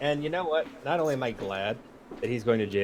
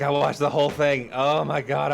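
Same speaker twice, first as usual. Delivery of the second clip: normal talking voice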